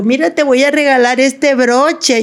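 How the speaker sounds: put-on high, sweet old grandmother. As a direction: offer the gift like a doting grandma. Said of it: "y me dijo". (voz de abuelita)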